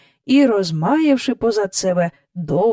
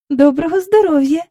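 high, raised, courteous greeting; polite girl; 9 semitones higher, 5 dB louder than usual. A narrator's reading. Jane greeting Miss Andrew despite dislike, polite. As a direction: greet her like a well-mannered child. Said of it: "вічливо сказала Джейн".

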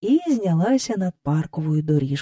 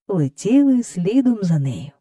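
ordinary delivery, nothing marked

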